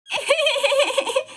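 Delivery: a sudden high giggle at the husband; high burst of laughter. Acted. Daughter-in-law laughing at father-in-law, high laugh. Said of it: "हंस लो, हंस लो".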